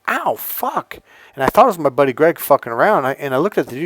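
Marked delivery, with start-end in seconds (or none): 0.1-1.0 sudden high yelp of pain; a sharp poked ow. Retold or imitated. Jabbed hard in the shoulder by the bouncer, a high yelp of pain. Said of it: "I was like".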